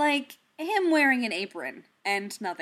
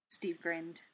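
plain reading voice

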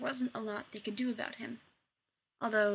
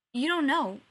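flustered stumbling; 4 semitones higher, 7 dB louder than usual. A narrator's reading. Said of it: "stumbling over his own words".

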